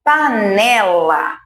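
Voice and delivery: "Panela" slowly drawn out over three syllables; sílabas esticadas. Shown as a demonstration. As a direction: stretch "Panela" so its length shows. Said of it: "panela são três fichinhas". ("Panela" bem devagar)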